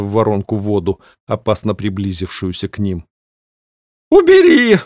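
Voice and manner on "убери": piercing high howl. Acted. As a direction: howl it in panic. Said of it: "взвыла книга". (пронзительный вой)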